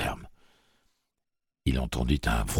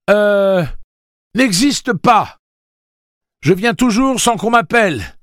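devinette criée; 12 dB louder, slower than usual. A narrator's reading. Susanna récite une devinette criée.

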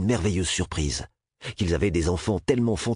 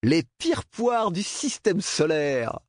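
slow, high laughing taunt; 11 semitones higher, slower than usual. A narrator's reading. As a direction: laugh through the insult. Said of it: "Il rit de plus belle".

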